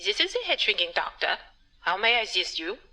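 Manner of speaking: put-on a doctor faking a German accent to be taken seriously; hammed-up German doctor accent. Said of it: "I've been brushing up on my German accent".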